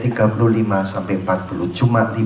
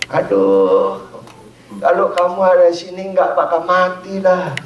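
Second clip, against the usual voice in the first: high, fast mock lament of a mourner; mock wailing lament; 8 semitones higher, 4 dB louder than usual. Grieving family wailing at the house, high and fast mock lament. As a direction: wail it as the grieving family would.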